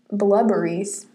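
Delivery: "blubberies" for blueberries, with a short "blub"; silly mispronunciation. Pretend. Playful mispronunciation, both laugh.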